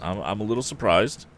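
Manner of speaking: ordinary delivery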